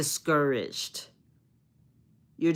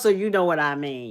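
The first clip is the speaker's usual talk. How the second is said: ordinary delivery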